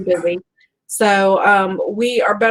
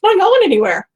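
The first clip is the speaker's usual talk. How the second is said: emphatic retort; 10 semitones higher, 6 dB louder, faster than usual. Retold or imitated. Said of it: "And I'm like".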